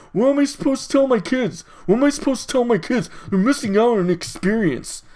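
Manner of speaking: high, hurried mock complaint; mock whining parent. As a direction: imitate an upset parent griping. Mimicking upset parents about cancelled Halloween.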